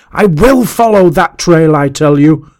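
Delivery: high, loud, furious insistence; shouting with rage. A narrator's reading. Master insisting on following the trail, shouting with rage. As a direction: roar it out in defiance. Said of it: "He shouted aloud with rage".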